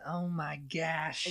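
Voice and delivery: slow, soft Midwestern accent. Shown as a demonstration. Showing how the Midwest talks, soft Midwest accent. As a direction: stretch it like a Midwesterner. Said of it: "in the Midwest. You have, like".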